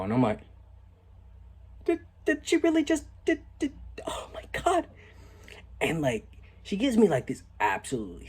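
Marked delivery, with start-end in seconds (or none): 1.8-4.9 very high, breathless disbelief; mock-stunned squeal trailing into a whisper; stunned stammer. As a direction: squeak and stutter in shock. Acts stunned reaction to her answer.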